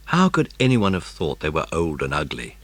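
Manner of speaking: ordinary delivery, nothing marked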